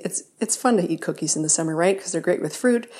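usual speaking voice

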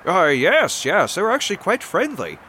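high, loud adventurer voice; Odysseus, eager. Acted. Plays Odysseus in the game.

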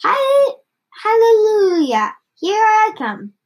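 high, loud whoop; grandma's jubilant shout. A narrator's reading. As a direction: belt it out high like a triumphant grandma. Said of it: "finding her voice at last".

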